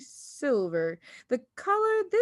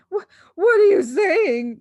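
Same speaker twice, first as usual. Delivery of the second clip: higher, louder panicked cry; 10 semitones higher, 11 dB louder than usual. A narrator's reading. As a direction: stammer in alarm. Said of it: "the husband cried".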